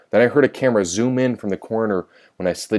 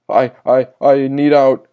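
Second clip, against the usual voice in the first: loud, raised, halting plea; stammer, then push out the plea; panicked stammer; 5 semitones higher, 6 dB louder than usual. A narrator's reading. Sister Manuka trapped in her cell, loud panicked stammer.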